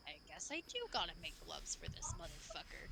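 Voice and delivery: low, soft resigned mutter; resigned grumble. Acted. Blacksmith resigning himself about Gray, low mutter. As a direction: grumble it under your breath. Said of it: "he's like".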